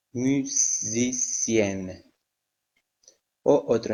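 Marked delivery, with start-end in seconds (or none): 0.1-2.0 musicienne stretched out, the final n held; very slow model. Shown as a demonstration. Teacher showing students the feminine ending, very slowly. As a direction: drag out musicienne so the ending is clear. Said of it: "teacher models the feminine ending".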